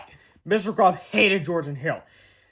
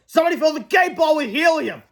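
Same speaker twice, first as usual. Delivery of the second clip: much higher, louder complaint; outraged fan; 9 semitones higher, 5 dB louder than usual. Acted. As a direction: shout the accusation. Fan accusing.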